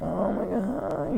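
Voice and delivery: high, slow mock moan of pain; hammed-up hurt whine; mock wailing. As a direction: wail it slowly, making fun of the injured friend. Mocking hurt friend holding head.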